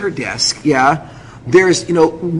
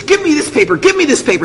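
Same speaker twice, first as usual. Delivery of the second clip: urgent demanding; 8 semitones higher than usual. Retold or imitated. Acting out the frantic clerk.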